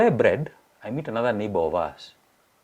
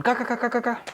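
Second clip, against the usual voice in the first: quick high ka ka ka of gulping a beer; high chugging noises; gulping sounds. Retold or imitated. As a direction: mimic swallowing in fast bursts.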